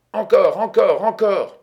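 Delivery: high, loud, feverish repetition; insistent demand. A narrator's reading. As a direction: demand another bet obsessively. Gambler insisting after losing.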